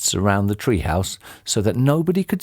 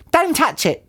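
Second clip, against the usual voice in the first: high-pitched warning cry; urgent cry. A narrator's reading. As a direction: warn him in a high voice. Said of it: "cried Missus Twit".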